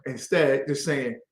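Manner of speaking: normal talking voice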